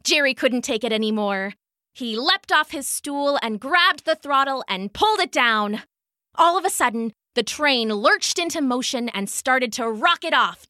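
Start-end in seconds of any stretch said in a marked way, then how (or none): none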